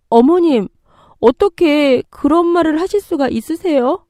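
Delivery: high-pitched, hurt disbelief; shocked protest. Acted. Daughter-in-law reacting to mother-in-law's cruel words, shocked.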